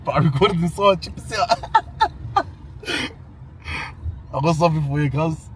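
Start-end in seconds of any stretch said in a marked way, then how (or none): 1.6-4.3 high squealing laugh. Real, not acted. Laughing while playing the recording.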